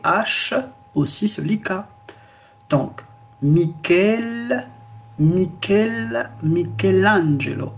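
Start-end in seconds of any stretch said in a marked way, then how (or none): 3.4-6.3 Michel with a hard k sound, mi-KEL; Italian ch as k. Shown as a demonstration. Teacher demonstrating Italian pronunciation to students, Michel said with a hard k. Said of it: "vous n'allez pas dire Michel Angelo". 6.4-7.7 Michelangelo said with a hard k, mee-kel-AN-jeh-lo; ch read as k in Michelangelo; Italian pronunciation. Shown as a demonstration. Teacher modelling the name for learners, Italian ch as k. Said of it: "vous allez lire Michel".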